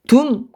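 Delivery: 둠 cut off short and sharp; staccato. Shown as a demonstration. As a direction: sing 둠 as one clipped note. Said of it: "끊었죠".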